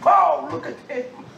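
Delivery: high, laughing exclamation; amused high cry; delighted laugh. Real, not acted.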